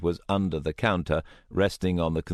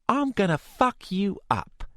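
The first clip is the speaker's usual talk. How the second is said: put-on much higher menacing London girl; threatening young woman. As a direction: snarl the threat.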